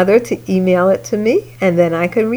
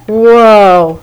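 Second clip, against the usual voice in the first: whoa; 6 dB louder than usual. Real, not acted.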